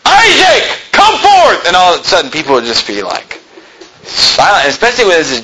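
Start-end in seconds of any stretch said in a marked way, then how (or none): 0.0-1.7 high, loud shout; full-voiced summons, pitched high; commanding call. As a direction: yell it out as if waking the dead. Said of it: "I say".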